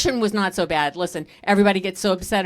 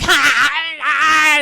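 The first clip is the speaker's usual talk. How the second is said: loud slow cackling shout; hammed-up toddler rage laugh; mock tantrum laugh-shout; 6 semitones higher, 6 dB louder, slower than usual. Toddler throwing a tantrum, mock laughing shout.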